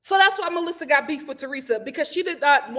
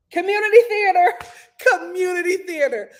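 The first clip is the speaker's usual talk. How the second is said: raised, theatrical mockery; mocking proclamation; 4 semitones higher, 6 dB louder than usual. Recapper judging Tracy's fake act, theatrical mockery. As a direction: pronounce the verdict with stagey scorn. Mocking her acting as amateur.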